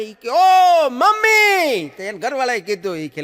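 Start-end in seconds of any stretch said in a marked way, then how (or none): 0.3-1.9 high, loud, slow scream of fright; wife shrieking at the horror film; frightened scream. Retold or imitated. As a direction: scream out in terror. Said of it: "જોરથી રાડ પાડી".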